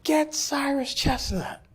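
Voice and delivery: high, slow shout across the hall; an audience member shouting his name; calling out from crowd. Retold or imitated. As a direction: call the name from the back of the crowd. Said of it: "gets up and say".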